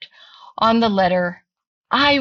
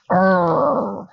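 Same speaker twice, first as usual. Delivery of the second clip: bear growl. Acted. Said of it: "Old Bear growled".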